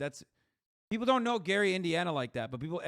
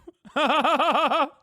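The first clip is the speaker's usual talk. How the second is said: loud laugh; 11 semitones higher, 12 dB louder than usual. Real, not acted. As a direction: burst of loud high laughter. Laughing at the story.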